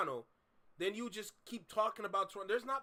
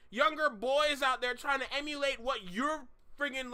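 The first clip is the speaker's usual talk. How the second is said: ordinary delivery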